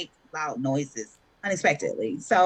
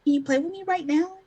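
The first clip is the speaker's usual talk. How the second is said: put-on child's voice; 7 semitones higher, faster than usual. Mother voicing her child asking.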